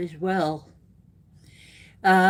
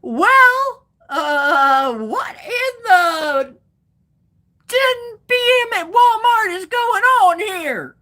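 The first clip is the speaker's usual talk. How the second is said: mock outraged shout; 11 semitones higher, 6 dB louder, slower than usual. Mock outrage at late-night Walmart.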